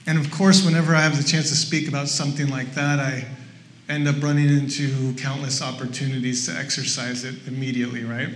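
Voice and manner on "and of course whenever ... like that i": normal talking voice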